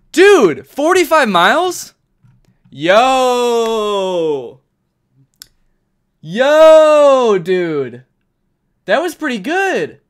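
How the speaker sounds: loud, high, slow whoops of delight; excited yelling. Real, not acted. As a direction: shout it like you can't believe it. Celebrating a close guess.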